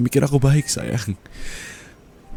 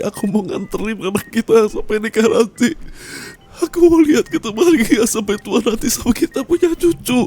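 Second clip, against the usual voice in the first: breaking into tears; 8 semitones higher, 3 dB louder than usual. Acted. Dying spouse to beloved partner, breaking into tears. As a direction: voice climbing higher and louder as the crying takes over.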